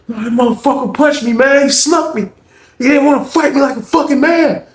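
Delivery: high-pitched aggrieved report; acted indignant complaint. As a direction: complain indignantly about being wronged. [name] complains to officer.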